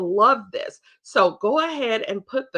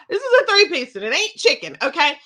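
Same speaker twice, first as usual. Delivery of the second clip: higher and louder, teasing the crowd with a punchline; playful sales joke; 5 semitones higher, 6 dB louder, faster than usual. Shown as a demonstration. Playful punchline to party guests, pitched high and loud. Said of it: "I say stuff like that now".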